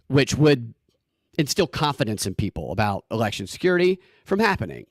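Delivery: ordinary talk, nothing marked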